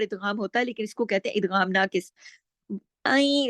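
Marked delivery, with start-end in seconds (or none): 3.1-3.5 आई drawn out partly through the nose; n of आई half heard, half merged; slightly nasal. Shown as a demonstration. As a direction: let the end of आई hum lightly in the nose. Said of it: "थोड़ी आवाज नाक में जा रही".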